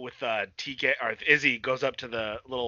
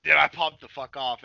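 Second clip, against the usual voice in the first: normal talking voice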